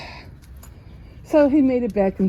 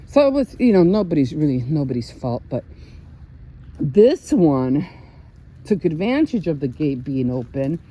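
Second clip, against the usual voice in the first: ordinary talk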